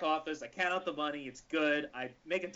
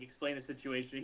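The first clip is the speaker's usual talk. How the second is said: ordinary delivery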